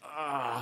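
short sighing groan; demonstrated groan, open and drawn out. Shown as a demonstration. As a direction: let out a pained sigh. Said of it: "So they're very different".

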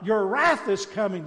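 loud, high, impassioned preaching; fiery preaching. Acted. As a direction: thunder it from the pulpit. Impassioned denunciation.

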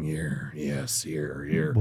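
a parking guy leading a driver to a special spot, low and hushed; low, quiet attendant voice. Retold or imitated. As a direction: drop the voice low and hushed like a shady guide. Attendant guiding car.

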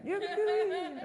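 a high Cajun-accent squeal, held back; Water Boy accent. Shown as a demonstration. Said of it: "You gotta do it with the accent".

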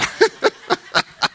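high burst of laughter; real laugh. Real, not acted. Preacher teasing Mets fans, laughing. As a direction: break up at your own jibe.

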